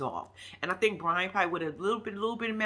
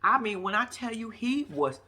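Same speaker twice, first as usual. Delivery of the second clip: ordinary talk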